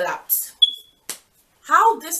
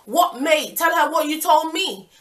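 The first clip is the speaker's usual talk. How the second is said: higher, louder, fed-up demand; exasperated demand; 7 semitones higher, 6 dB louder than usual. Retold or imitated. Girlfriend losing patience with her boyfriend, raised demand. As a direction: snap at him to spit it out.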